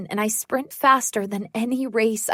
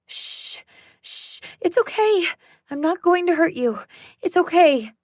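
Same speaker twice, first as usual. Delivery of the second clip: hushed shh, then higher coaxing reassurance; calm a frightened dog with a gentle higher voice; tense whispered shushing to a dog; soothing hush; 7 semitones higher than usual. Acted.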